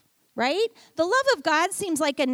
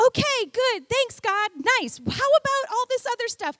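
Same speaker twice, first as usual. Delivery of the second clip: high, loud mock-grateful quip; sarcastic thanks to God; wry thanks; 9 semitones higher, 4 dB louder than usual. Pastor answering God's hints, wry mock thanks. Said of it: "I was like".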